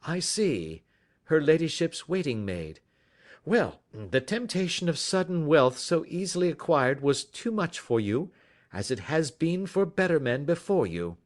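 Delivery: polite detective taking charge, lightly voiced; lightly voiced Holmes. A narrator's reading. Holmes accuses.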